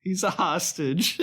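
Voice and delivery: stall, then own up awkwardly; imitating a hesitant, sheepish explanation; sheepish admission. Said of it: "The delivery of this".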